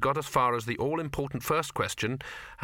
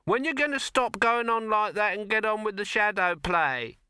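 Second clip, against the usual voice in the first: heckling woman; 10 semitones higher than usual. A narrator's reading. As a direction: jeer at the showman. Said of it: "the woman next to him".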